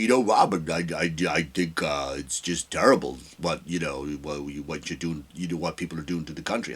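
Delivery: low, soft mumbling impersonation; horrible Howard Stern impression. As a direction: growl it out low like a bad Bane. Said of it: "It's a horrible Howard Stern impression".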